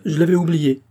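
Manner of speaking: high, emphatic cry of realisation; exclamation. A narrator's reading. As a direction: exclaim it as a sudden remembering. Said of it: "s'écria-t-il".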